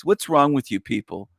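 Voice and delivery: ordinary talk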